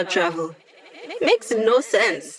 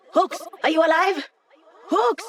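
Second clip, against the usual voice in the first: much higher urgent call of the name; 9 semitones higher than usual. Acted. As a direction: shout for a teammate who went down. Calling a downed teammate.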